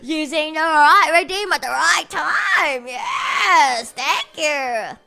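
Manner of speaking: loud, high gushing gratitude; effusive thanks. Acted. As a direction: gush it loudly with hammed-up appreciation. Effusive thanks for correct redeem.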